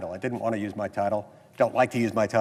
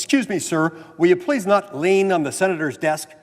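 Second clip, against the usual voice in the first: harsh tone; 6 semitones higher, 6 dB louder than usual. Retold or imitated. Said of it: "somewhat harsh tone of voice".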